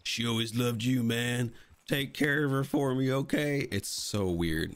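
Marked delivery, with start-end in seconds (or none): 0.0-3.7 higher-pitched mock dying plea; dying man. Dying man to John about his wife, mock plea. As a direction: imitate a dying man's earnest plea. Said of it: "as Dan Reed's dying".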